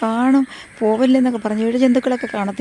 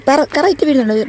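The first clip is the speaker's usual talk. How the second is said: urgent warning; 7 semitones higher, 7 dB louder than usual. Real, not acted. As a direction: warn sharply about the drip. Warning child about dripping sap. (വെപ്രാളത്തോടെ ഉച്ചത്തിലുള്ള മുന്നറിയിപ്പ്)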